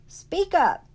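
very high, very loud bark; roaring. Acted. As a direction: roar it at the boy. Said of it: "roared Beauchamp".

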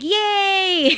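high, loud, drawn-out cheer; cheering along. Retold or imitated. Cheering for a stranger's good news, excited. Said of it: "I said it like that".

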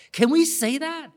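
ordinary talk

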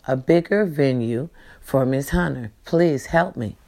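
ordinary talk

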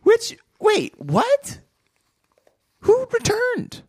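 high, startled and confused; suddenly baffled, voice jumping up; surprised. Real, not acted. Sudden confusion over chapter title.

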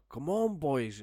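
loud, high yelled encouragement; imitating a shouting analyst; mock shout. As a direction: bellow it like a touchline fan.

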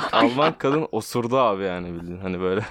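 laughing through the words. Real, not acted. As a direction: talk while laughing. Laughing while telling the story.

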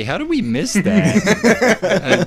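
high, drawn-out, laughing astonishment; mock disbelief. Society reacting to hidden cups, mock disbelief. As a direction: wail it slowly in mock dismay. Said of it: "we would be like, as a society".

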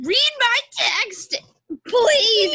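high, loud, drawn-out demand; demanding plea. Retold or imitated. Girl acts out her text to a friend, demanding plea. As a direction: plead loudly and slowly, hammed up.

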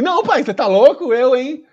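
loud, high, embarrassed refusal to dad; loud protest. Retold or imitated. Boy loudly refusing to show his father. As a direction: push dad off, half-shouted.

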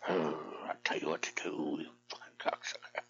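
low hushed mock of his wife, muttered. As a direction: sneer her bossy line under the breath. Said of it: "you wanna be the ruler of the roost".

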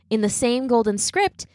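plain reading voice

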